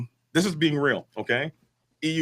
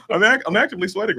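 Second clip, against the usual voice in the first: laughing; 8 semitones higher, 4 dB louder, faster than usual. Real, not acted. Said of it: "I'm sweating a little bit".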